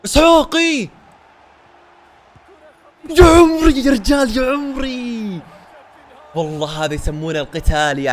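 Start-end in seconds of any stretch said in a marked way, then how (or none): 0.0-5.4 loud high drawn-out cheer for the goal; excited shout. Real, not acted. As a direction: yell it slow and high in joy. (صيحة فرح عالية ممطوطة)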